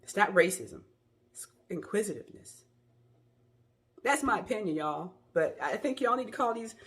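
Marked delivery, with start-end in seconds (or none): 0.0-2.6 quoted question. Retold or imitated. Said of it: "Based on your outfit".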